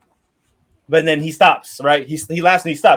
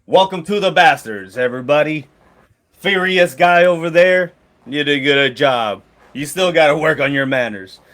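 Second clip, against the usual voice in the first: mercenary leader welcome; 3 dB louder than usual. Acted. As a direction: greet the company like its proud captain. Leader NPC greeting recruits.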